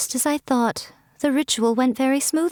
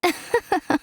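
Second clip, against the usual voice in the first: amused laugh; 8 semitones higher than usual. Acted. Miko amused at their worry.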